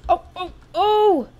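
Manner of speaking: riding the thrill of the chase; excited yelps. Real, not acted. Dodging in tense final round.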